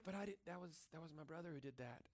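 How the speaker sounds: put-on a child pleading it wasn't him; child's excuse. Child to angry dad, softly blaming his brother.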